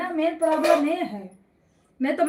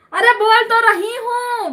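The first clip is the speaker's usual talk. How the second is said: calling out louder and a little higher; raised voice; 6 semitones higher, 6 dB louder than usual. Real, not acted. Telling her brother she is speaking, calling out louder. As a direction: raise the voice so the listener hears. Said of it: "इतना चिल्ला चिल्ला के बोल रही हूँ".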